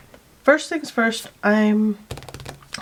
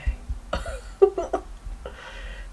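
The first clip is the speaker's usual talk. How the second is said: amused high laugh; 13 semitones higher than usual. Real, not acted. Artist amused at her own odd voice, laughing. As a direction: laugh at yourself.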